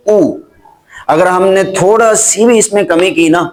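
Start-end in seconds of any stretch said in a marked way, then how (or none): 0.1-0.4 ऊ said with lips pushed fully round. Shown as a demonstration. Teacher demonstrating ऊ with rounded lips. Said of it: "गोल करके पढ़ेंगे".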